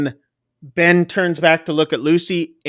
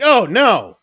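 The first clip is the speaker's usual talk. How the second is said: mock dismay; 7 semitones higher, 5 dB louder than usual. Man recoils from bugs in chips.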